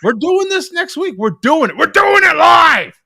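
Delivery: high, loud, excited yell; fired-up shout. Pretend. Co-host jumping at a new segment idea, excited shouting.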